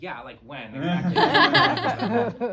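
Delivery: slow, drawn-out eager reply through laughter; milk it, laughing; laughing comeback. Retold or imitated. She answers the monster's insult, laughing and slow. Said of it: "And I said".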